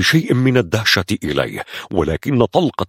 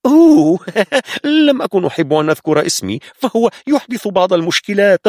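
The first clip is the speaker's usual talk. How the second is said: high, giggling ironic reply; pirate mocking the soldier with a laugh; sarcastic; 10 semitones higher than usual. A narrator's reading. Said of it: "واضح فيها السخرية".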